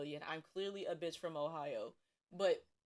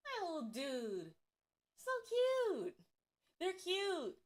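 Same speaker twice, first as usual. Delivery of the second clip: cooing; 9 semitones higher, slower than usual. Real, not acted. Cooing at octopus.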